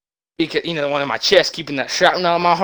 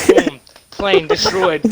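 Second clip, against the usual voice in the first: laughing; much slower than usual. Real, not acted. Laughs while telling the crash.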